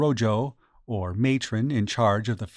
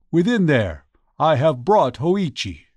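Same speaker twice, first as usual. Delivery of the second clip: raised, announcing cry; loud voice; 3 semitones higher, 4 dB louder than usual. A narrator's reading. Retainer announcing Hoichi at the entrance, loud cry. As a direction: cry out loudly to those within. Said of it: "cried in a loud voice".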